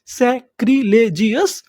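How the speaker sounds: सैक्रिलेजियस said slowly with a rising end; slow model reading. Shown as a demonstration. Teacher repeats the word slowly for the class. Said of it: "उसे हम लोग क्या बोलते हैं".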